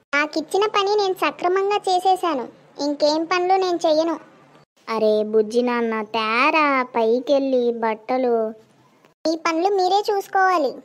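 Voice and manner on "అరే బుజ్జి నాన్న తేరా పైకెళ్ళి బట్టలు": lower, slow coaxing; coaxing sweetly. Acted. Mother coaxing her little son to fetch clothes, slow and sweet.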